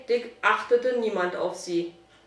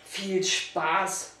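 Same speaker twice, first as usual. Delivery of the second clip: low, soft grumble; grumbling; 3 semitones lower, 4 dB quieter than usual. A narrator's reading. Boy echoes the departing rider's wish, grumbling. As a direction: grumble it back sourly under your breath. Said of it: "brummte Fabian".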